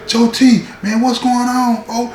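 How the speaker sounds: high called-out yell from a cell; yelling from the hole. Retold or imitated. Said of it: "So he yelling".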